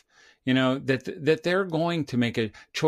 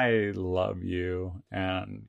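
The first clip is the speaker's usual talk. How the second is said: slow and tender at first, then quickening; gentle reassurance; 4 semitones lower, much slower than usual. Retold or imitated. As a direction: lean in and say it like a hug to your kid. Parent reassuring a teenage child.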